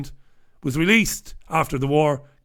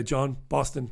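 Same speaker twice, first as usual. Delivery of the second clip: usual speaking voice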